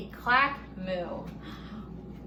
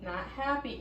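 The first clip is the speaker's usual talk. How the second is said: ordinary delivery, nothing marked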